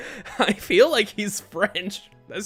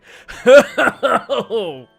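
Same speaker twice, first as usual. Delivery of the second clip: loud, high stagey laugh; character laugh; 6 semitones higher, 14 dB louder than usual. Acted. Killer laughs at the attorney, loud stagey laugh. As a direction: let out a big rolling laugh, in character.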